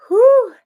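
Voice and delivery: high, loud whew of release; loud exhale of relief; relieved whew. Real, not acted. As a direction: blow out the intensity. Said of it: "That Gemini energy was so intense".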